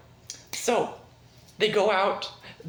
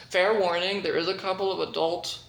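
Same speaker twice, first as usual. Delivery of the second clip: ordinary delivery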